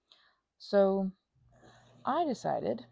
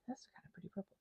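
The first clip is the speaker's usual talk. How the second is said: soft whispered admiration; 14 dB quieter, faster than usual. Real, not acted. Admiring the purple she mixed, whispered. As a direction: whisper it in awe.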